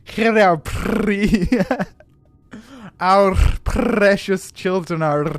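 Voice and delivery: put-on "our precious children are" very slow with every R trilled; rolled R's. Shown as a demonstration. Streamer attempts every rolled R in "our precious children are". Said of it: "fuck, I missed one".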